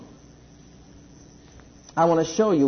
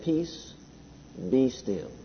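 much softer, hushed calm command; 3 semitones lower, 7 dB quieter, much slower than usual. A narrator's reading. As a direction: quietly still the storm. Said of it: "very calmly says".

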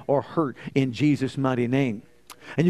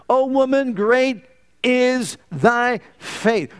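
raised and drawn out, each word stretched very slowly; grand slow pronouncement, a touch higher and louder; slow solemn Jesus; 7 semitones higher, 3 dB louder, much slower than usual. Acted. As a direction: proclaim it like Jesus blessing her. Jesus answering the woman.

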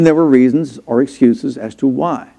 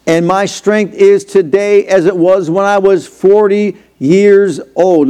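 slow, high declamation from the pulpit; preacher proclaiming an old warrior's words; raised preaching; 4 semitones higher, slower than usual. Acted. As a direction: declaim scripture on a high, sustained pitch.

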